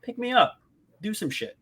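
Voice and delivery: a hint of a coaxing magic sword; talking blade. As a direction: call out high, pausing between phrases. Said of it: "pretty much telling him".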